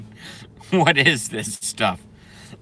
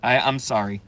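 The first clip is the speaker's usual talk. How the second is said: usual speaking voice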